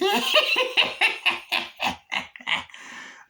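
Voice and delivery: high, wheezy villain cackle, long and trailing; Chucky. Retold or imitated. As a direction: cackle on and on in imitation. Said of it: "he starts going".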